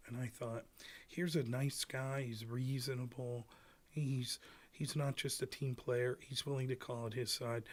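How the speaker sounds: understated, hushed mock disappointment; mock concern troll. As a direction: lightly voice a sanctimonious fan letting him down. Voicing a faux concern troller.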